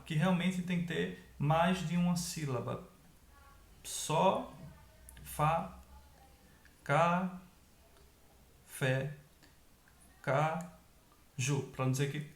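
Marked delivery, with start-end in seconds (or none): 3.8-5.8 SO and FA as separate beats; syllable by syllable. Shown as a demonstration. Teacher separates syllables of sofá. 6.8-9.2 CA and FÉ as separate beats; syllable by syllable. Shown as a demonstration. Teacher separates syllables of café. 10.2-11.6 CA and JU as separate beats; syllable by syllable. Shown as a demonstration. Teacher separates syllables of caju.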